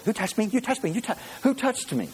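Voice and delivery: put-on high-pitched, pestering little kid; three-year-old. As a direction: repeat it like an insistent small child. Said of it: "it feels like a three-year-old".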